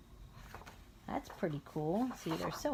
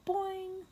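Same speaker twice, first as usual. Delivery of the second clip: bouncy boing; 12 semitones higher than usual. A narrator's reading. Sound effect in story.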